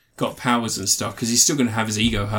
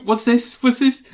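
high and louder, rising excitement; excited dog teasing; 10 semitones higher, 4 dB louder than usual. Retold or imitated. Owner teasing the dog with keys, excited and high. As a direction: tease like waving a toy at a dog.

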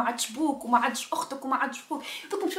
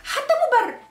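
حتى مبرر said much higher, hammered; stress on حتى مبرر; 12 semitones higher, 3 dB louder than usual. Real, not acted. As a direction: hit حتى مبرر high and hard. High emphatic repeat.

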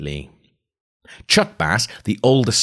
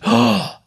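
a sharp excited gasp; thrilled gasp; 5 semitones higher, 5 dB louder than usual. Real, not acted. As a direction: gasp at the discovery. Reader's thrilled reaction.

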